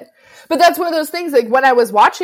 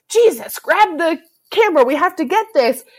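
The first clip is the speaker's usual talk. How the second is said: urgent; 8 semitones higher than usual. Retold or imitated. Reporter Gail rushing Kenny to film the arrest, urgent. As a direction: push the cameraman to roll now.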